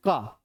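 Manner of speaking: low, unstretched "क"; short, no resonance. Shown as a demonstration. Teacher proving the sound isn't pulled, "क" kept short. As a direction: drop "क" briefly without letting it ring. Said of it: "ज़्यादा तो sound नहीं खींचा इसमें".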